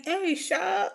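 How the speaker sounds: high imitation of a Southern call; Southern accent. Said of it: "and they be like".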